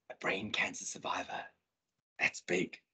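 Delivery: low, near-whispered weight; very soft, low and solemn; hushed. Real, not acted. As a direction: drop to a hush and let it land. Quiet climax of motivational talk.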